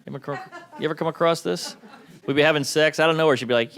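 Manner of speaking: normal talking voice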